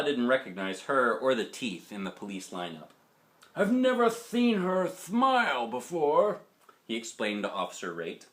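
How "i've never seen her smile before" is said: put-on high, slow old man voice; grandpa explaining.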